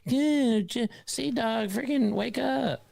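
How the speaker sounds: put-on high, slow whiny kid; kid brother voice. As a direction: imitate an annoying little brother. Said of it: "They're like".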